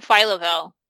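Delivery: "Phyla-Vell" as FILE-uh-vell; long i in the first syllable of "Phyla-Vell"; pronounced file-a-vell. Shown as a demonstration. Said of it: "I've always said".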